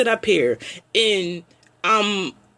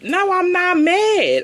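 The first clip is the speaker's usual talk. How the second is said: higher, slow and emphatic; slow, raised insistence; firm denial; 8 semitones higher, slower than usual. Real, not acted. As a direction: insist each word, slowly. Denying she is mad.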